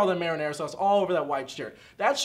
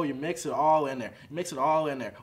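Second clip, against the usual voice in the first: ordinary talk, nothing marked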